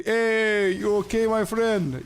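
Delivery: high, friendly mock Cypriot greeting; Cypriot accent. Stranger greeting an American driver in a Cypriot accent. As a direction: imitate a Cypriot local checking on you. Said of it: "That's the Cypriot".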